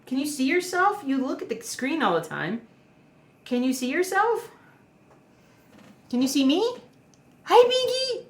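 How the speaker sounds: high singsong questions to a dog; high, lilting pet voice. Real, not acted. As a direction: coo at your pet, rising to an excited squeal. Baby-talks to dog at screen.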